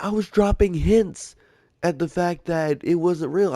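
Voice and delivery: high, snippy imitation of the girlfriend; mocking girlfriend. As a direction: mimic her defensive confession in a high voice. Girlfriend claims she dropped hints.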